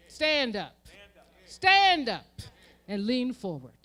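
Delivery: building exhortation; rallying shout. Acted. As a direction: rouse the congregation. Rallying call.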